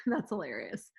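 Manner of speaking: soft breathy laugh through the words; can't stop giggling as she says it; laughing quietly. Real, not acted. Host cracking up at the belly button cheese, laughing. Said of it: "I'm laugh".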